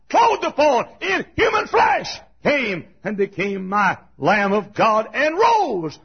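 high, loud revival preaching; impassioned pulpit shout; full-force preaching. Real, not acted.

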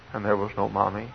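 ordinary talk

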